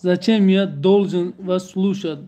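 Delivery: ordinary talk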